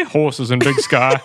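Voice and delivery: Montana drawl dropped from falsetto, amused; Montana accent. Acted. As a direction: switch into Montana cowboy talk. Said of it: "my Montana accent".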